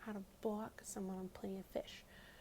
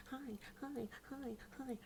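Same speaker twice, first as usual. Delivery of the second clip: higher, softer singsong repeats; mock pestering; 4 semitones higher, 5 dB quieter, slower than usual. Guy texting her over and over, mock pestering. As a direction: imitate a needy texter, lightly and higher. Imitating his nonstop pestering texts.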